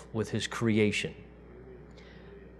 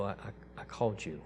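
hushed tender voice; 3 semitones lower, 11 dB quieter than usual. Acted. God speaking at judgment, hushed.

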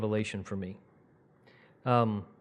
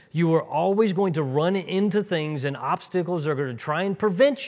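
raised, higher sermon delivery with driven emphasis; impassioned preaching; 8 semitones higher, 8 dB louder than usual. Real, not acted. Preacher urging church to fight the whole way there, fervently. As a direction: preach it with urgency, pitch held up.

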